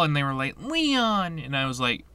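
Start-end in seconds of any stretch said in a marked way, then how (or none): 0.6-1.4 high, drawn-out mimicked name; mock correction. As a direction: mockingly parrot the wrong name up high. Retold mocked wrong name.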